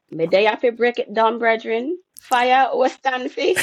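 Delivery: hammed-up Jamaican patois; Jamaican dialect. Shown as a demonstration. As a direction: read it out in a put-on Jamaican accent. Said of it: "in a Jamaican dialect".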